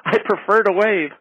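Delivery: high, light imitation of [name]'s polite brush-off; [name]'s reply. Drew hears the guest imitate [name]'s polite refusal, high and light. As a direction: lightly voice her sweet, final refusal. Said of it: "And she said to me".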